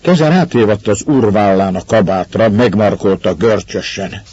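ordinary delivery, nothing marked

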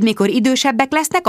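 plain reading voice

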